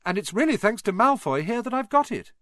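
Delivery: high, innocent-sounding dig; cheeky. Acted. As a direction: say it with fake innocence. Harry teasing Malfoy.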